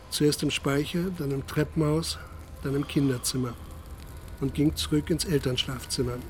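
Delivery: ordinary talk, nothing marked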